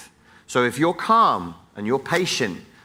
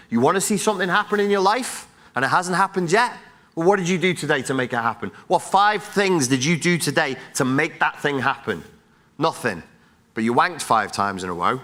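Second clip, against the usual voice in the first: normal talking voice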